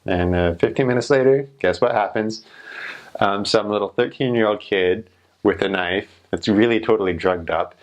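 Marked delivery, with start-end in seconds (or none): none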